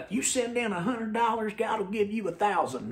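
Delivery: put-on high-pitched televangelist accent; TV preacher accent. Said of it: "I don't know why I did that accent".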